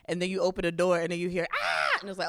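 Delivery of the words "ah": quick mock shout of the kids; sudden mock scream; retold kids' scream. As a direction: burst out yelling like the kids. Kids screaming when door opens.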